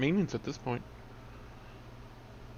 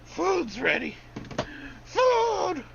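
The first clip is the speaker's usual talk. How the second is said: calling out; much slower than usual. Real, not acted. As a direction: yell that dinner is ready. Calling out from another room.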